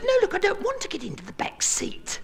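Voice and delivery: put-on high whispered falsetto girl; whispered high-pitched refusal; girl's voice. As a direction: breathe it like a shy girl. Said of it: "And the girl said".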